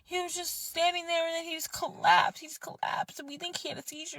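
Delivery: high, fading mock account; godbrother explaining. Godbrother explains the collapse, high then trailing soft. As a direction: tell it up high, sinking to a hush. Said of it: "He's like".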